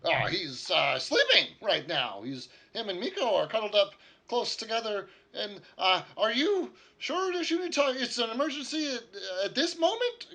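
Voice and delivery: put-on high, loud flustered old man voice; fussy startled old wizard; sleepy old wizard. Wizard answers at the door.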